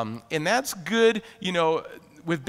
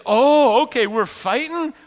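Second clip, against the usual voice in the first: loud sudden "Oh" then eager; hammed-up sudden realization, louder; mock surprised; 4 semitones higher, 8 dB louder than usual. Preacher voicing the Arameans, mock excited surprise. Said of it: "they say".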